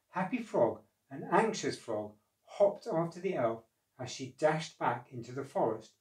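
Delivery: ordinary delivery, nothing marked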